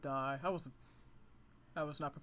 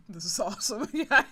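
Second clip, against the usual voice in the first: laughing; 5 semitones higher, 7 dB louder than usual. Real, not acted. Laughing at Pokemon.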